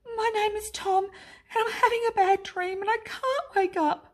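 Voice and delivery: put-on high, childlike voice of a lost boy; a little boy's high voice; small child Tom. A narrator's reading. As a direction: sound like a small scared child. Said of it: "said the child".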